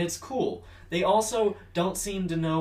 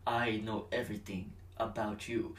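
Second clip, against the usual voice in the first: low menacing quote; 4 semitones lower, 6 dB quieter than usual. Retold or imitated. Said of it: "he's like".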